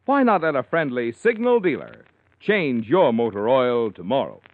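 normal talking voice

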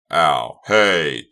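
loud yelp of pain; pained cry. Acted. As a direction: cry out as you get hurt. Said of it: "Doug cried".

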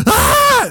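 high-pitched mock scream; imitated scream. Girl reaching out for help, high imitated scream. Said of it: "and she starts screaming".

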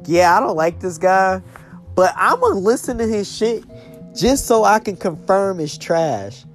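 loud, high mocking bro voice; frat bro. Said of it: "you know how crazy you sound?".

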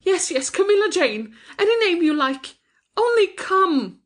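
very high, desperate surrender; passionate plea. A narrator's reading. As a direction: give in and beg with all your heart. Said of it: "she said passionately".

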